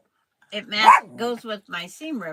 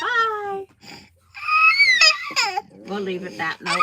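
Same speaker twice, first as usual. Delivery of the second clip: laughing; 12 dB louder than usual. Real, not acted. Laughing goodbye.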